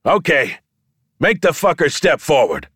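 high, ringing command; shouting. A narrator's reading. Showman sets his giant pulling, shouting. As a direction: holler the start to everyone. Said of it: "and shouted".

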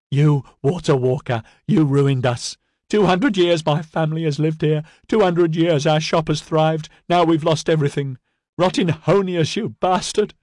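high, loud furious tirade; furious woman. A narrator's reading. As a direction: hurl every word at him. Bloodied shopkeeper cursing Edyard.

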